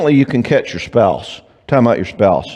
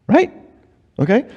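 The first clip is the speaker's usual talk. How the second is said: usual speaking voice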